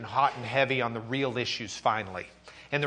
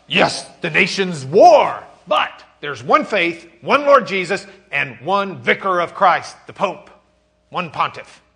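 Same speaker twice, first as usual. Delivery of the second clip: booming Eck; 4 semitones higher, 11 dB louder than usual. Pretend. Eck voiced in debate.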